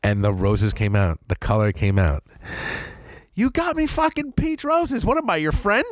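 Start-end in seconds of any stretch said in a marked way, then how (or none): 3.3-5.8 high mock-indignant wife; wife's indignant complaint. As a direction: accuse him over the roses. Wife voiced during argument.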